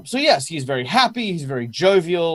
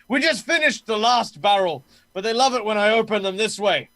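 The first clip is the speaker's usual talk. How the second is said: higher showman's voice to a crowd; jovial guard captain; 7 semitones higher than usual. Acted. Captain atop a mead barrel addresses crowd, showmanlike. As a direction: announce it merrily, pitched up.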